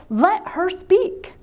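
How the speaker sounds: slow, high, emphatic demand; angry retort. Retold or imitated. Telling an interrupter off for a crying friend, angry. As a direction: draw out each word, exasperated and insistent. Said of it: "I just got so mad".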